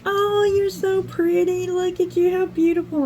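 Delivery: lavish high-pitched dog talk; baby talk to a dog. Retold or imitated. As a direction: coo at the husky in a high sweet voice. Cooing at a cute husky.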